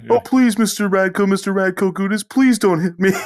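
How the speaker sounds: high, loud squealing plea; mock begging. Scared fan begging the big player not to hit him, squealing high. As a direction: beg for mercy in a high squeaky voice.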